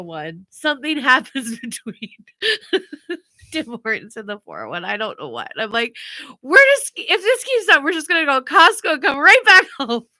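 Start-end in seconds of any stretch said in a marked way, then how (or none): 6.4-10.1 loud, high exasperated outburst; frustrated threat. Retold or imitated. Parent threatening to cut the trip short, exasperated. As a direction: re-enact a fed-up parent raising their voice.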